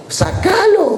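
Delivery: high, punched comic command; emphatic urging. Retold or imitated. Pastor urging her to withdraw it.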